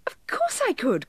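high, gleeful little elf; tiny elf, thrilled to help; delighted cry. A narrator's reading. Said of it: "cried the elf in delight".

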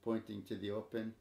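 ordinary delivery

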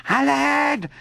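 put-on a boy hanging from a branch crying for help. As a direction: yell for help as you slip. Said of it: "cria-t-il".

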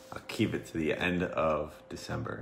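mumbled under the breath; quiet. Real, not acted. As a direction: say it half to yourself. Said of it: "You gotta speak up".

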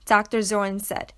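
ordinary delivery

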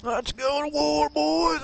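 put-on rally the boys to war; war cry. Hyped war cry to squad.

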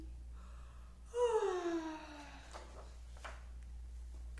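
long soft sigh; contented sigh. Shown as a demonstration.

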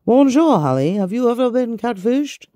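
high, playful mock French accent; put-on French accent. Host asking a viewer's question, put-on French accent. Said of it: "I'm gonna do it in a French accent".

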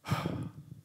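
long exhale of mock defeat; deflated sigh. Young minister hearing his coach's hard questions, deflated sigh. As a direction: sigh as the great idea crumbles.

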